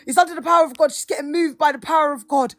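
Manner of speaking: high, loud, urgent preacher patter; imitated street preacher. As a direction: mimic a preacher loudly reassuring the crowd. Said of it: "You know, he talks like that".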